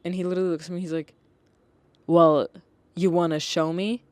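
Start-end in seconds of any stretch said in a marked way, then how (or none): 2.1-4.0 loud, cheeky challenge, mock sass; sassy. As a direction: imitate a sassy little boy, loud. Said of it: "sassy king".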